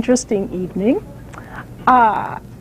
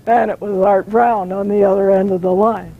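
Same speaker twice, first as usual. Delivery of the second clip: ordinary talk, nothing marked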